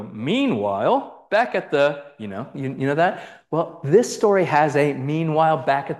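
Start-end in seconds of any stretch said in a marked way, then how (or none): 0.0-2.0 slow, drawn-out scene-change announcement; cartoon narrator. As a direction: imitate an old TV narrator, stretching the first word. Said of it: "and the narrator go".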